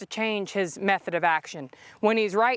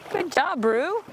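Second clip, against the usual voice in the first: much higher, sing-song good job; high praise; 8 semitones higher, slower than usual. Real, not acted. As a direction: praise the horse brightly. Praising horse in water.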